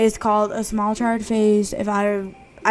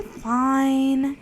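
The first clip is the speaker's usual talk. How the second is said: normal talking voice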